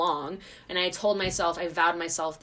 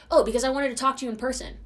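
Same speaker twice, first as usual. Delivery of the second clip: high, fast, louder mock excuse; imitating the boy; 7 semitones higher, 6 dB louder, faster than usual. Boy explaining the unanswered text, mockingly imitated. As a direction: mimic him in a raised, hurried voice. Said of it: "And he's like".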